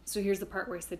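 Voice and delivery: usual speaking voice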